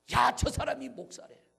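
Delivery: high, loud mock gossip; acted loud, incredulous outburst; outraged exclamation. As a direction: cry it out as the scandalous climax. Gossip climaxes on the pastor.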